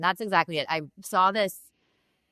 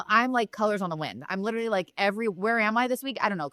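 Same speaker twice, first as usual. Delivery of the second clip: ordinary talk, nothing marked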